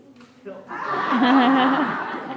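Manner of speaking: big burst of laughter; long, loud cackling laugh. Real, not acted. Teacher laughs after throwing the ball, loud laughing.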